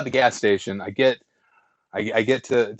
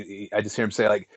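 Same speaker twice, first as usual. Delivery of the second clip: normal talking voice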